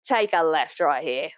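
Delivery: slow, low, sneering cartoon computer; drawn-out haughty machine impression; sassy computer. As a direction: drawl the insult like a snide navigation voice. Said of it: "I would love him to be like".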